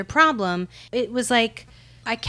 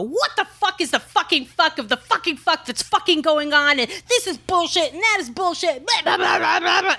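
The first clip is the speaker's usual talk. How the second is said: high-pitched, breathless imitation of a ranting correspondent; hammed-up exasperated outburst; mock venting rant; 9 semitones higher than usual. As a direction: rattle off an over-the-top tirade, pitched way up. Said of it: "their moment of venting".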